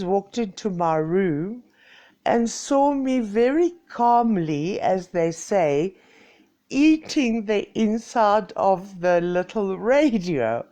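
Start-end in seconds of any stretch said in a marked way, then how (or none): none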